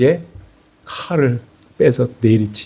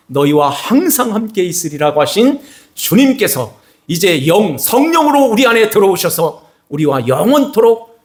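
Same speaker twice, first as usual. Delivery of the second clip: full-force sermon climax; fervent preaching; 4 semitones higher than usual. Acted. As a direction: preach it out, building with fervor. Preaching crescendo.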